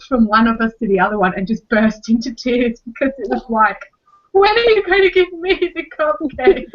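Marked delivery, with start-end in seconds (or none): 4.3-6.7 put-on high, whiny little-child voice; upset toddler voice. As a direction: put on a wounded toddler's wail. Voicing crying toddler's thoughts.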